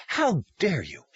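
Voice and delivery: outraged. Acted. Caseworker confronts Tom, indignant. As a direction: throw the accusation at him.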